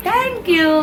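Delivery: put-on high, lilting model for a toddler; sing-song prompting. Shown as a demonstration. Aunt teaches toddler to say thanks, sing-song prompting.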